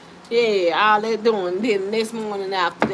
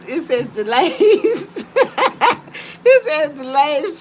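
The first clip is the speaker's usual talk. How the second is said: laughing; 5 semitones higher, 4 dB louder, slower than usual. Real, not acted. Laughing at the card.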